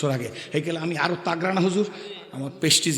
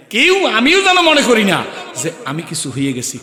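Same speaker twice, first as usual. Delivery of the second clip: loud warning; 5 semitones higher, 8 dB louder than usual. Real, not acted. Warning the audience against pride.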